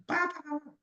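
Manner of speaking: quick high babble standing in for quechua; mock foreign babble. Preacher mimicking the husband's quechua, high quick babble. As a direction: rattle off nonsense syllables like fast foreign speech.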